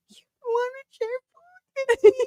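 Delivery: high, whispered tearful squeak; mock crushed. Boyfriend reenacts first refusal of food, mock crushed. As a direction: whimper it almost without voice. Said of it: "the first time that it ever happened".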